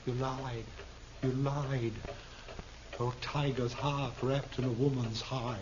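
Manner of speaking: quiet bitter accusation. Acted. Hostage-taker accuses the woman.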